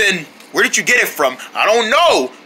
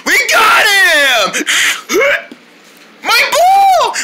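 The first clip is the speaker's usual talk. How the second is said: high triumphant yell; triumphant shout; 13 semitones higher, 3 dB louder, much slower than usual. Acted. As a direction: cheer the win. Triumphant cry.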